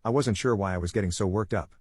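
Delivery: plain reading voice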